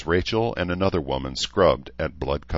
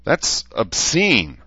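disgusted; 8 semitones higher, 6 dB louder, much slower than usual. Acted. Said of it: "Rachel said with a grimace".

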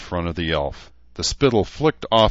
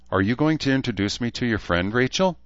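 quick, higher-pitched tart demand; miffed friend demanding an introduction; tart, pissed; 7 semitones higher, faster than usual. A narrator's reading. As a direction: hurry through it, rising sharply on the name. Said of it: "from the tart tone that she was pissed".

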